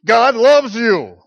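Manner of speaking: booming loud and slow, mock angry; fist-shaking mock shout. Shown as a demonstration. Preacher demoing a bad gesture, mock furious shout. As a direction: shout the tender words like a threat. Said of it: "To do things like this".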